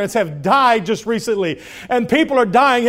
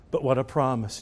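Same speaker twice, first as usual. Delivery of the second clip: ordinary delivery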